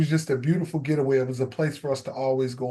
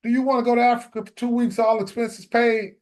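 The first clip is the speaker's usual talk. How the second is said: higher, fast, pushy wife voice; impatient wife; 6 semitones higher than usual. Retold or imitated. Wife pressing husband for a decision, impatient and higher. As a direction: hurry him along, teasing then insistent. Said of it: "she's like".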